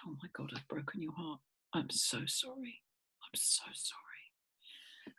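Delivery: whispered, heartfelt remorse; hushed apology. Retold or imitated. Owner to dog whose heart she broke, whispered apology. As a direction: whisper it tenderly, as if comforting the hurt one.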